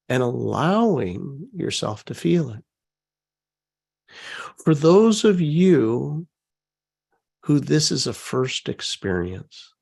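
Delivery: soft, unhurried guiding voice; calm meditation guide; guided meditation. Acted. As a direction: ease them gently into the feeling. Leads a guided feeling exercise.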